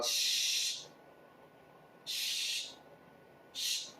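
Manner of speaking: short hissed shh bursts; imitated running water. Tap at the work bathroom, imitated hiss.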